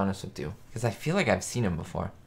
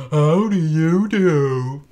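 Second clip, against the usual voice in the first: slow, loud, higher, drawn-out "How do you do"; Frank Nelson greeting; 6 semitones higher, 6 dB louder, much slower than usual. Retold or imitated. Streamer imitating the sitcom greeting, slow and loud. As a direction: stretch the greeting out like a showman.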